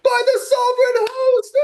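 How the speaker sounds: high mock crowd shout; awestruck crowd. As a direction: exclaim like an amazed spectator. Astonished crowd cries out.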